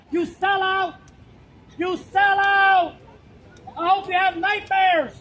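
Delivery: loud, slow furious yell; angry shout. Real, not acted. Activist shouting at the senator leaving, furious yell. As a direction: hurl the insult at her.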